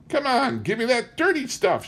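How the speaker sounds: high, whining plea for the jug; mother begging her son, high whine. Acted. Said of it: "she whined".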